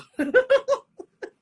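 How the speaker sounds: high, loud burst of laughter; laughing. Real, not acted. Preacher to congregation after confessing what the word shows, laughing. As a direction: break into a sudden high laugh. Laughing at herself mid-testimony.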